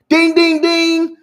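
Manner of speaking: ordinary delivery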